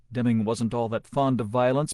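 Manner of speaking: plain reading voice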